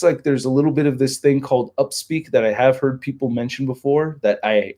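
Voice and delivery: usual speaking voice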